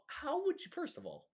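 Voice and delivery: a boss who refuses to believe it; indignant rant. Acted. A company president rants at the intruders, high and incredulous.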